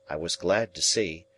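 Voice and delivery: ordinary delivery, nothing marked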